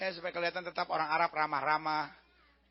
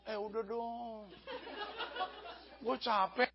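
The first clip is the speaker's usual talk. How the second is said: soft, slow, worn-out protest; tired mock pleading; weary plea; 2 semitones higher, 9 dB quieter, slower than usual. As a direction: beg off as if exhausted. Acted weary soldier pleading with enemy.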